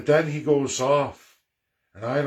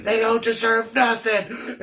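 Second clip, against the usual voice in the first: imitating the host's screaming tirade; mock furious yell; 9 semitones higher than usual. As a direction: rant like a furious radio host. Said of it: "And he's going off".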